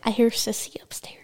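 hushed whisper. Real, not acted. Mother and daughter whispering about sister upstairs. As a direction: whisper so Sissy upstairs won't hear.

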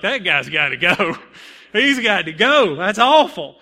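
hammed-up loud complaint; mock outraged verdict. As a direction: play a fed-up audience member, half laughing. Audience's imagined verdict on him.

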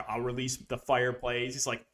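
normal talking voice

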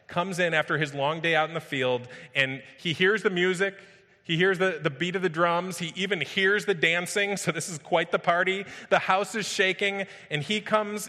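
high, loud, building preacher's intensity; preaching at full force. Acted. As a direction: paint the party scene with rising fervor.

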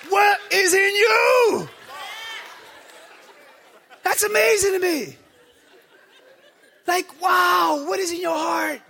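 high, loud, incredulous preacher cry; preaching at full force. Acted. Pastor asking the church what drives reviewers, loud preaching. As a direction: shout it out in disbelief.

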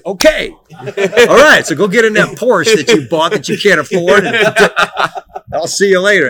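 high, loud, laughing send-off; amused dad dismissal. Retold or imitated. Father sending son off to his Porsche, loud laughing dismissal. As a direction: wave him off like an amused father.

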